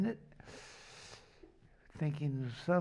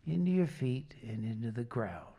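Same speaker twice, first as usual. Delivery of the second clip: calm group breathing guide; guided relaxation. Acted. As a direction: talk them gently through each breath.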